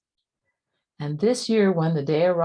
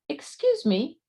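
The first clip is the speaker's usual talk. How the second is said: polite cricket; 9 semitones higher than usual. A narrator's reading. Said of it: "coughed a cricket".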